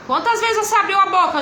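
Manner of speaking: a hint of classroom teacher voice; quizzing a child. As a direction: quiz a young pupil encouragingly.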